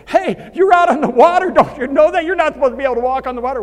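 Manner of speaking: high, loud, fast mocking shout; mock disciples yelling. Disciples shouting at Peter out on the water, mock-high and loud. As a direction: heckle him from the boat.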